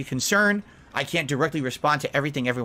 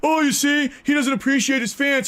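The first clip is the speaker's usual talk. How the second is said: high, loud exaggerated complaining; high, loud offended fan voice; mock chatter; 11 semitones higher, 3 dB louder than usual. Shown as a demonstration. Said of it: "And then I say".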